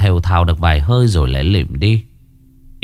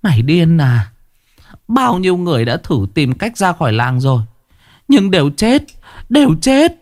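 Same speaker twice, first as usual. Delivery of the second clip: put-on panicked old woman; 8 semitones higher than usual. A narrator's reading. Old neighbour warning Uyên everyone died leaving, rising panic. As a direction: build to a high frantic cry.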